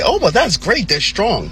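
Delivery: high, mock-cheery imitation of outsiders; mock cheerful. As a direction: chirp it like a naive onlooker. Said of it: "And you may say".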